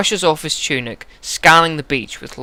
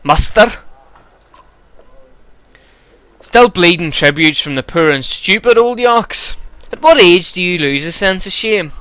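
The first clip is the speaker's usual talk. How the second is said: put-on gruff Glaswegian accent, pitched up; Hakon; 5 semitones higher, 5 dB louder than usual. Giant grumbling about tributes, gruff Glaswegian accent. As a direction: complain in a rough Scottish brogue. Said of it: "we'll give him a Glaswegian accent".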